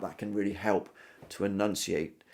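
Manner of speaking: usual speaking voice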